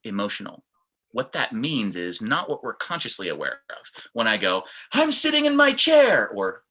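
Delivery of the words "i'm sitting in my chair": high, loud and bright with excitement; excited. Shown as a demonstration. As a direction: burst it out eagerly. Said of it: "It looks like Mike is a little bit down".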